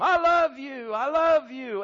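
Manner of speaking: high, loud, ringing repeat; shouted echo, easing slightly the second time; echo of a yell. Retold or imitated. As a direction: call it back like a mountain echo. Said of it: "echoing back".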